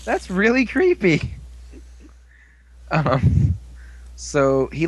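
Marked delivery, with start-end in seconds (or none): 0.1-1.3 high, creeped-out squeak; a high, creeped-out little yelp; squeaky creeped-out. Retold or imitated. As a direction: squeal it like a weirded-out critter. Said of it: "And it's like".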